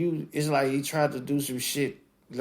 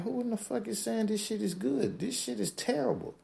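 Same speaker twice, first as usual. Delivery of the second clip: higher, indignant disbelief; mock outrage; 12 semitones higher, 3 dB quieter than usual. Reading fans' comments, higher scoffing verdict. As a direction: scoff at the praise in a high voice. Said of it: "I'm like".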